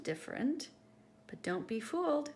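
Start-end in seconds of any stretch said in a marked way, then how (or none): none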